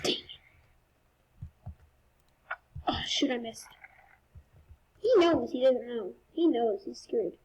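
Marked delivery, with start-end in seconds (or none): 0.0-3.7 frustrated whisper after a miss. Real, not acted. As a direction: hiss it under your breath. Unvoiced muttering after miss.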